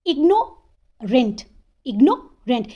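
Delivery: Ignorant slowly, pause before the final rent; two-beat sounding out of ignorant; broken into syllables. Shown as a demonstration. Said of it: "यहाँ पे भी दो syllable.".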